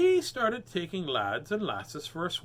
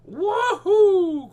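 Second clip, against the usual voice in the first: very high, loud whoop; pirate whooping over the treasure; joyful cry; 13 dB louder than usual. Acted. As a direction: whoop with glee. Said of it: "cried the terrible".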